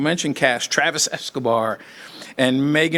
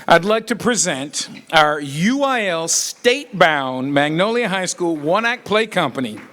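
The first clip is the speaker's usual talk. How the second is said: announcer introduction; 6 semitones higher than usual. Real, not acted. Announces the play company.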